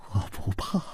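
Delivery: ordinary delivery, nothing marked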